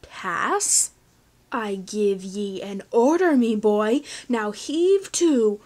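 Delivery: loud, slow pirate captain growl. A narrator's reading. Captain ordering Finn, growled pirate threat. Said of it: "the captain growled".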